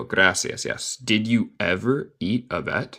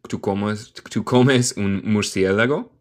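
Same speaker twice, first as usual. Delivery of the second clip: normal talking voice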